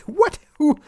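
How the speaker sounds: squeaked high, startled question; high squeaky startled who; startled what. Retold or imitated. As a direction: jump up in pitch, caught off guard. Acting out surprised reaction.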